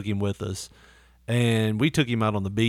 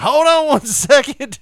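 loud, high, slow call to the door; stretched-out high shout; 13 semitones higher, 8 dB louder, slower than usual. Retold or imitated. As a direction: holler from the recliner. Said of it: "I'm like".

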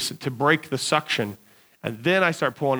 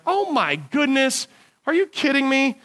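higher-pitched retold exclamation of dismay; mock disbelief; 9 semitones higher than usual. Man reliving his foot sinking in mud, mock disbelief. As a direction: act out being stunned, drawing out the first words.

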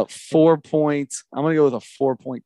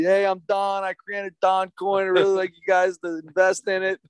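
crypto bro impression; 7 semitones higher than usual. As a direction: sell the coin like a proud founder. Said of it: "it's gonna be".

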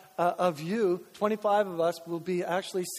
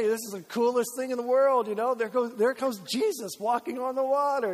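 higher, wide-eyed mock amazement; excited kid; 4 semitones higher than usual. Kid watching felt board before video games, excited awe. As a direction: gush like an awed child. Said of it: "And as a kid".

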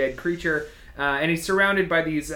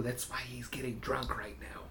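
unvoiced, softer conspiratorial aside; whispered aside; 5 semitones lower, 16 dB quieter than usual. Real, not acted. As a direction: whisper it behind your hand. Aside about drunk character.

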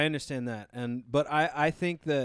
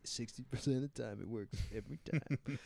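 movie quote; 10 dB quieter than usual. Retold or imitated. Quoting Anchorman movie line.

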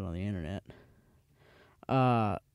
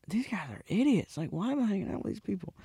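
whispered aside, raised pitch; private scornful whisper; 7 semitones higher than usual. Retold or imitated. Narrator thinking his companions are idiots, whispered aside. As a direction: mutter it to yourself in disbelief.

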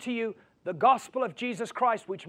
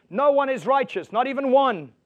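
higher, louder, hammering each word; emphatic repeat; 5 semitones higher, 7 dB louder than usual. Shown as a demonstration. Preacher answering the Mother Teresa objection, loud and emphatic. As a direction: slam it home the second time. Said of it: "what about Mother Teresa?".

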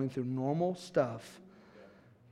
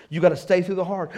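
louder, faster, driving it home; emphatic repeat; 9 dB louder, faster than usual. Shown as a demonstration. Pastor to church, punched loud repeat. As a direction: hammer the line a second time. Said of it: "And you gotta stay through the hard".

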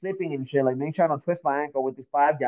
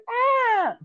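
imitated scream. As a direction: mimic a startled girl's scream. Girl's frightened scream imitated.

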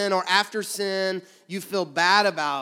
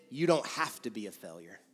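softer and lower, kindly; soft voice of conviction, lower and gentler; gentle encouragement; 7 semitones lower, 5 dB quieter than usual. Shown as a demonstration. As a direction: reassure quietly. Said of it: "You see the difference?".

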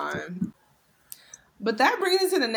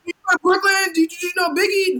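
high, loud mock eager questions; high, loud imitated eager questions; eager questioners; 9 semitones higher, 5 dB louder than usual. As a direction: mimic curious locals pestering. Foreigners' eager questions quoted.

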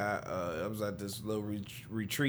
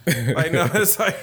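laughing; 7 semitones higher, 9 dB louder, slower than usual. Real, not acted. Laughing at the idea.

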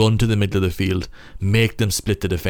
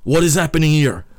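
playful mock French accent, a bit higher and louder; hammed-up French accent, puzzled and dramatic; 7 semitones higher, 5 dB louder than usual. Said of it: "if he had the French accent".

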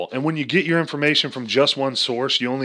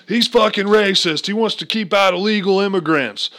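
mock outraged protester; 8 semitones higher, 5 dB louder than usual. Mocking angry critics of the wall, high and loud.

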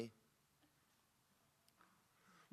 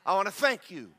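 louder, higher, fervent prayer of thanks; rising, fervent thanksgiving prayer; 5 semitones higher, 5 dB louder than usual. Shown as a demonstration. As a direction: pray it out loud with full conviction. Demonstrates his morning prayer aloud.

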